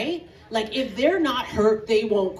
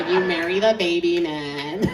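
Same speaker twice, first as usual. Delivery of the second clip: ordinary talk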